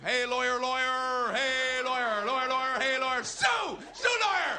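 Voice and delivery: loud monotone chant leaping to a screech; heckling chatter. Acted. Grown-up applies chatter to a lawyer, loud heckling. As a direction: drone on a high note, then shriek.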